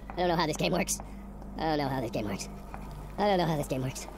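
low, soft mumble to yourself; defeated muttering. Real, not acted. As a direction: repeat it under your breath, low. Muttering helplessly, repeated.

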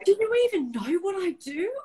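high-pitched, wailing mock protest; retold tearful outburst. Triggered mum crying at her daughter, high tearful outburst. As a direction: act out crying at your kid, high-pitched. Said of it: "I instantly cried and went".

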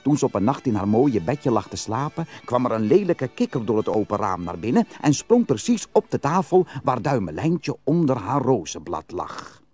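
plain reading voice

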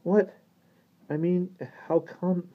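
high-pitched, bewildered questioning; confused boy stammering. A narrator's reading. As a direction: falter in a high boyish voice. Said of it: "stammered Johnny in confusion".

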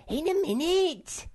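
high, loud squeaky shout back; Sniff. A narrator's reading. Sniff answers from down the cleft, shouting back. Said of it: "Sniff shouted back".

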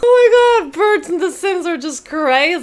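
loud high exclamation easing down; amused, astonished high cry; amazed outburst. Real, not acted. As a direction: burst out high, then settle. Astonished at crazy pigeons.

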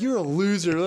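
high sneering mock collector; mock creditor. Creditor insult, high far.

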